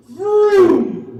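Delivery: loud high revving vroom; mock engine vroom. Cyclist taunting the comic as he passes, loud vroom. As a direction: rev it like a kid's toy car. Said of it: "he leans in and goes".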